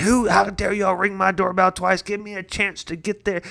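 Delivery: high-pitched, indignant mock outburst; livid grumpy man. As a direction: imitate an irate old man telling someone off. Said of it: "this old grumpy man opened the door".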